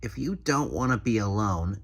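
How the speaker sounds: ordinary talk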